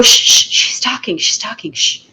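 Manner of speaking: hushed unvoiced shushing of classmates; shushing whisper. Students whispering to classmates to hush for the teacher. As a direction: hiss at friends to hush up. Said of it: "all their friends will go".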